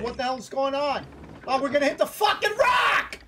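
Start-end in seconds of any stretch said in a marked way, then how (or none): 1.5-3.2 high, rising cry of alarm; alarmed shout. Real, not acted. Player warning crewmates about the rock, alarmed shout. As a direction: yell it as the crash looms. Sees boat heading into rock.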